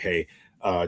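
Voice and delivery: ordinary talk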